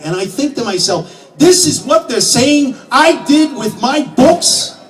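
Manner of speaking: a shouted, betrayed tirade; outraged rant. Acted. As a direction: rail against what they made of his books. Outraged at kids, drunken rant.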